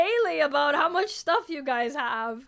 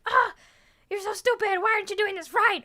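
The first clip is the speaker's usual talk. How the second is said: mock harsh coach; 5 semitones higher, 4 dB louder, faster than usual. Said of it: "with a bad attitude".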